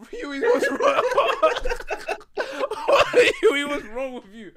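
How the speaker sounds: high, slow words broken by real laughter; laughing disbelief. Real, not acted. Fan laughing at Huey's cold answer, cracking up high. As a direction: howl the question through laughter. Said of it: "laughing disbelief".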